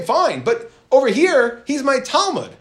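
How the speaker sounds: a teacher voicing an offended rabbi; indignant protest. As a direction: insist he has every right to be upset. Yochanan insists student is his.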